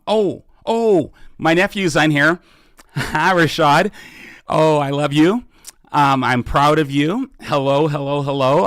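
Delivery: light up and call out to your nephew with a laugh; excited greeting. Real, not acted. Spots nephew on the livestream.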